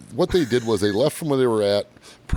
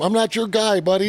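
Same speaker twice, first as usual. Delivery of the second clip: high, loud mock Canadian retort; squeaky jokey Canadian comeback, pitched way up; hammed-up Canadian "not your guy, buddy"; 12 semitones higher, 3 dB louder than usual. Quoting Canadian meme line.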